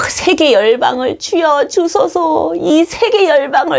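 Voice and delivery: high, slow, swept-up plea; fervent mission prayer. Retold or imitated. Student missionary praying for all nations, mock-fervent. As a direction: imitate a zealous student pleading with God. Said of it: "주소 들은 게 많어".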